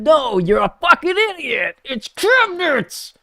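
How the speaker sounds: high, shrill-pitched mock of the other side; mocking whiny voice. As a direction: squeak it out like a sneering know-it-all. Acted quarrel, mocking other side.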